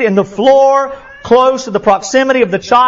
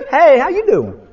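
fake cheerful; 5 semitones higher than usual. Answers phone sweetly after fighting.

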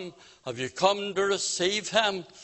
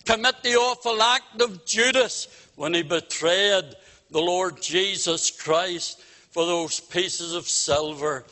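normal talking voice

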